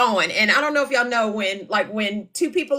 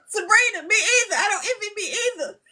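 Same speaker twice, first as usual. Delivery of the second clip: high laughing cry, half shouting; 12 semitones higher, faster than usual. Real, not acted. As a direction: cackle through tears at the scolding. Laugh-crying at mother's scolding.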